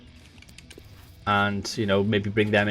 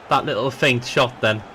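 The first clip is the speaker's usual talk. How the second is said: ordinary talk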